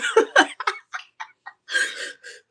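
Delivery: high teary laugh mixed with a sob; real laugh-cry. Real, not acted. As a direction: laugh at yourself while crying. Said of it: "you're boohoo crying on here".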